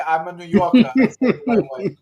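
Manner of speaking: light Brooklyn swagger; mock New Yorker. Shown as a demonstration.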